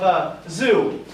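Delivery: ordinary talk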